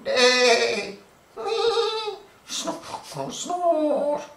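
high neighing and snorting; horse noises. Acted. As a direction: play the horses whinnying.

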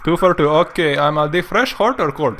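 put-on friendly Indian accent, retroflex t and d. Prank-calling the drive-thru worker in a mock Indian accent. Haggling over nuggets.

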